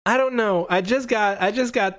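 put-on high, unsure child's voice; helpless kid trainer. Young trainer explaining his new Pokemon to referee, helpless.

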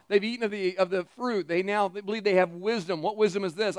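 ordinary talk

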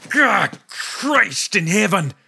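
high, slow, pained wail. A narrator's reading. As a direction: cry out as the shin gets kicked. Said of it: "the man wailed".

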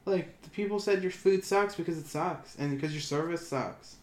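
loud, high-pitched NO, then easing slightly; exasperated rebuttal. Retold or imitated. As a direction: set deluded owners straight, loudly. Acted rebuttal to deluded owners.

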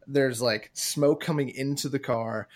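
ordinary delivery

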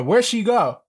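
shouting into the chat for her; calling out. Real, not acted. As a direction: call out for her, building to a shout. Yelling for the missing girl.

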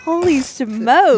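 slow loud startled exclamation; alarmed. Real, not acted. Scared by fast driving.

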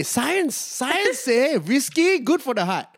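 put-on high, slow drunk Indian uncle; hammed-up Indian uncle lecturing; Indian uncle accent. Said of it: "you're like those Indian uncles".

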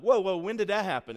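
high, loud, startled whoa. Retold or imitated. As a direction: caught off guard by the news. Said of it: "Danielle said something like".

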